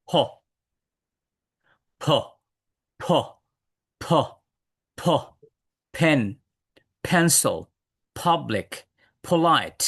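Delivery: "ফ, পেন, পেন্সিল" one by one, each p puffed lightly from closed lips; aspirated p. Shown as a demonstration. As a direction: let a little air out on every p from "ফ" to "প্রেস্টিজ". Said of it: "হালকা বাতাস বের করব".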